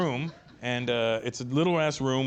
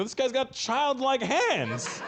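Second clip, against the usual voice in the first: very high, drawn-out ridicule; teasing, stretched-out squawk; mock amazement; 12 semitones higher, much slower than usual. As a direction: announce it in hammed-up disbelief. Retold mocking announcement to the prince.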